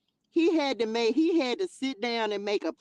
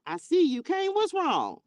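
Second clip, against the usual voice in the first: high, knowing God addressing Cain; lightly voiced God, pitched up; playful voice of God; 7 semitones higher than usual. God checking on Cain, lightly voiced. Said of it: "Here go God".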